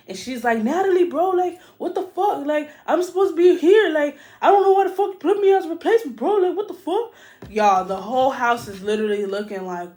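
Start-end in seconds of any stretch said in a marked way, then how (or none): 0.6-7.1 high, loud mock rant; rapid high-pitched aggrieved outburst; upset, going off. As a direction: imitate a worked-up housemate going off. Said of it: "aggressive and upset".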